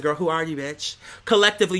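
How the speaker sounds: ordinary talk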